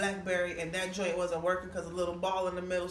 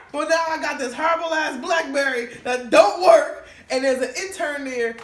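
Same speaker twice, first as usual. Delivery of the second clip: excited; 6 semitones higher, 11 dB louder than usual. Retold or imitated. Still buzzing after the scream.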